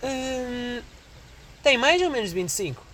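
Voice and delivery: imitating the saleswoman asking his age; saleswoman's voice. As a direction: play coy as you ask. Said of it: "e ela".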